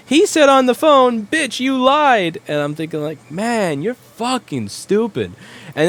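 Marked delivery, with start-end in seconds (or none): 0.1-2.4 high, loud, slow mock of a furious woman; mocking angry customer. Retelling a furious customer quoting him on the phone, in a high, loud, slow mock voice. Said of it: "she got really pissed off and said". 3.3-5.3 exasperated thought. Retold or imitated. Inner thought about angry customer.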